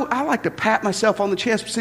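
ordinary talk, nothing marked

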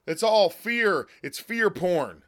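slow and heavy, stress on "all"; slow emphatic. Real, not acted. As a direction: lean hard on "all" in "It's all fear". Ranting at the government shutdown.

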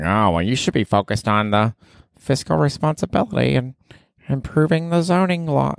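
high-pitched, prissy politician voice; mock politician. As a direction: lecture like a fussy politician. Mimicking fussy politicians lecturing the mayor.

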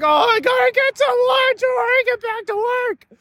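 very high put-on panicked voice; hammed-up, high-pitched lunch-hour panic; mock frantic. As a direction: squeal it like someone in a comic rush. Mimics frantic people at lunch hour.

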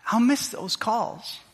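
slow, grieving admission; real sorrow, drawn out; emotional. Real, not acted. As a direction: hold back tears as you say it. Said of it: "sorry, I'm going emotional here".

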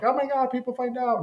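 high mock gasp of alarm; hammed-up high alarmed voice. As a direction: squeal it in fake panic. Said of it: "they're like".

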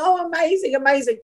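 high-pitched retold gushing; eager, lifted praise; enthusiastic approval. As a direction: gush in imitation of impressed friends. Retold friends' enthusiastic reaction.

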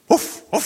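high, loud imitated dog bark. Dog answers butcher in joke.

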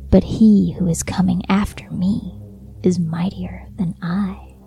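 breathy eerie whisper. Acted. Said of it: "She whispered that time".

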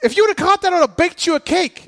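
high, loud coach's shout; screaming from the dugout. Retold or imitated. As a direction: holler it across the diamond. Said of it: "I'm screaming this from the dugout".

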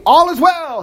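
high-pitched triumphant call; crying out. A narrator's reading. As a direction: proclaim it to the king, pitched well up. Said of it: "Ahimaaz cried to the king".

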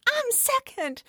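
put-on high toddler voice announcing it; proud toddler. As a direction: squeak it out like a proud little kid. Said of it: "and says".

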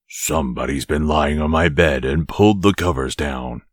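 low, great big growly voice; growling father bear. A narrator's reading. Father bear finding his bed disturbed, low growl. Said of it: "he growled in his great big voice".

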